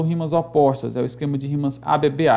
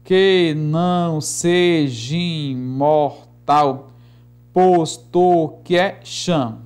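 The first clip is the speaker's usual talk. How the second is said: slow, evenly stretched verse; chant-like metrical reading; syllable-by-syllable scansion; much slower than usual. Shown as a demonstration. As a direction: give every syllable its own long beat. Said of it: "Vamos pegar aqui esse penúltimo".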